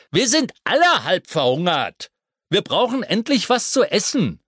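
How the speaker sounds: loud, high, impatient creature; loud centipede voice. Acted. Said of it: "Der Tausendfüßler richtete sich".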